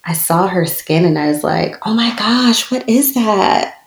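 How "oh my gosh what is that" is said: shocked discovery. Retold or imitated. Discovering skin condition on daughter.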